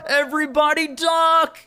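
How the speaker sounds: high, loud yell of alarm; shouted warning. Acted. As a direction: yell for everyone to duck. Rick yells warning.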